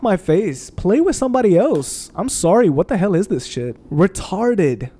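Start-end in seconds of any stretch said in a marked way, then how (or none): none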